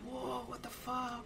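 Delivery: high, slow shocked exclamation; stunned. Retold or imitated. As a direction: let the shock drag out. Crowd reacting to heel turn.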